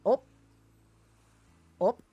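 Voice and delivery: quick Midwestern oop of polite surprise; Midwestern "ope". Shown as a demonstration. Streamer demonstrating a habit, short Midwestern exclamation. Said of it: "do some of the Midwestern thing".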